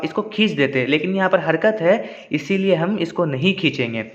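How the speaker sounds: normal talking voice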